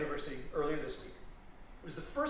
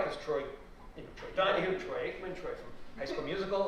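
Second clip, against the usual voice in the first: ordinary talk, nothing marked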